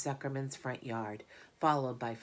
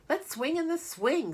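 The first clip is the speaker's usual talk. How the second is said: much higher, louder eager call; eager kid; 13 semitones higher, 5 dB louder than usual. Acted. Avery calling Fern to the barn swing, high and eager. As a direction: pitch it up like an excited boy.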